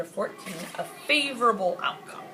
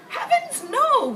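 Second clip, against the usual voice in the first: much higher, louder jolly royal exclamation; a jovial king brushing off the worry, way up high; high, hearty king's denial; 6 dB louder, slower than usual. Acted.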